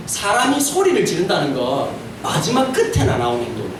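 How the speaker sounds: ordinary talk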